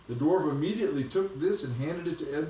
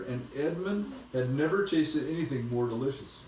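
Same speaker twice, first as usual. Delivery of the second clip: ordinary delivery, nothing marked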